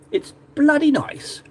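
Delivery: hammed-up British accent, slow and high. Shown as a demonstration. Repeating the phrase to viewers in an exaggerated British accent. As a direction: stretch it out in mock-British delight, high-pitched. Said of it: "That's a very British way of saying it".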